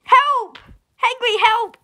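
high-pitched pretend plea; mock cry for help. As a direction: call out for rescue, playfully. Acts out calls for help.

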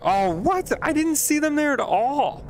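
high-pitched, incredulous outburst; real shock at being caught out; indignant surprise. Real, not acted. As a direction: protest in a high, rising voice of disbelief. Shot down by unseen enemy.

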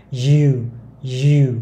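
u pushed out loud, teeth apart; u with open jaw. Shown as a demonstration. Teacher drilling u, teeth kept apart. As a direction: say u without the teeth touching. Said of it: "hãy đọc u".

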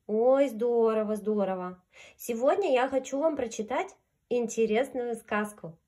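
plain reading voice

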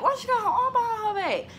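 quizzical inner voice. Retold or imitated. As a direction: wonder it aloud, suspicious. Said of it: "kinda was like".

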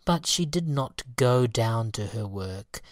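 ordinary delivery, nothing marked

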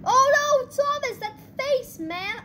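high-pitched exclamation, loud at first; amused dismay. Real, not acted. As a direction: cry out high, then drop the volume. Reacts to crashed Thomas.

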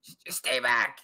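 restrained creature cry; mock scream. Griffin repeating its warning to the adventurers, half-screamed. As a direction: warn them off as a frantic griffin.

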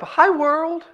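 put-on very high, sweet baby hello; baby greeting. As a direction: coo it like a happy baby. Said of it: "She just looked up".

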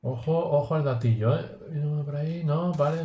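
ordinary talk, nothing marked

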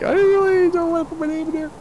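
high-pitched retold protest; mimicking daughter. Dad mimicking his daughter about the chat, high mock protest. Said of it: "She's like".

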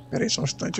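whispering. Real, not acted. Pauses to look around quietly.

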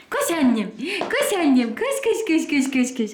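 excited, rising run-run cheer. Real, not acted. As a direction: urge the dog to run.

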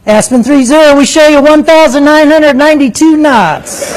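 high, lilting read-back, savoured; same controller sing-song. Retold or imitated. The controller reading out the speed to the Aspen crew, in high sing-song. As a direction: deliver the big number proudly. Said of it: "that same voice".

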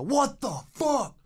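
high, drawn-out mock wail; long high-pitched cry of mock alarm; imitated freak-out. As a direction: wail it slowly like a startled stranger. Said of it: "you hear this voice in the corner".

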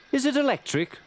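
put-on high-pitched child's question; curious child. Bridget asking grandfather about the tram, curious child. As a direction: ask it like an eager child.

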